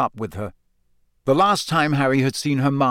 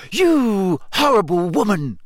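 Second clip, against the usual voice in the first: slow, high, louder seething; through gritted teeth; 8 semitones higher, 4 dB louder than usual. A narrator's reading. Hermione rounding on Rita through gritted teeth. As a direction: force it out through clenched teeth. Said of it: "she said through gritted teeth".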